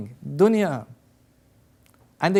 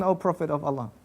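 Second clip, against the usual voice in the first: tender address; 4 semitones lower, 5 dB quieter, faster than usual. Retold or imitated. Sawad's tender closing address.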